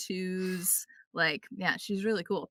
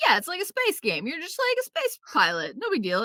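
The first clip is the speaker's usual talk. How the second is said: breezy downplaying; 9 semitones higher than usual. Imitating breezy game developers downplaying it.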